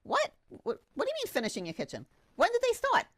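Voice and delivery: a sudden jolt of surprise; incredulous client-side shock. Retold or imitated. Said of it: "I'm like".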